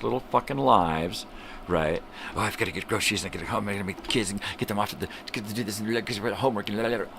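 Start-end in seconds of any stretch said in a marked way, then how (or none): 2.3-7.1 fast, breathless mock self-absorbed chatter; mocking busy mom. Mocking a rushing mother's excuses, fast and breathless. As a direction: rattle it off like a harried mom.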